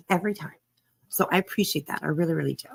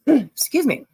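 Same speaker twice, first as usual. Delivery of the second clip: hoarse; 7 semitones higher, 6 dB louder than usual. Real, not acted. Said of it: "I'm sounding a little hoarse".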